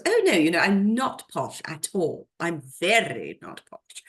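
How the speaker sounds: posh Oxford accent, mock-protesting; Miriam Margolyes. Host imitates an actress denying she is posh, exaggerated posh accent. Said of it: "whenever she goes on a show, she goes".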